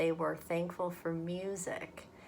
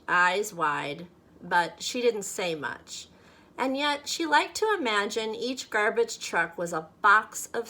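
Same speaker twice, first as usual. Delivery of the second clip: lively, louder storybook voice; story reading to children; 5 dB louder than usual. A narrator's reading. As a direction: read it aloud like a picture book for kids. Reads picture book aloud to children.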